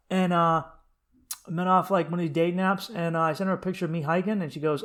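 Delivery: ordinary delivery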